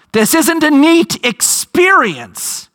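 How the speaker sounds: usual speaking voice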